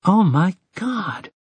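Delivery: slow, sighing in wonder; boy sighing in amazement at the dance; awed sigh. A narrator's reading. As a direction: let it out on a breath. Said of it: "Jake sighed".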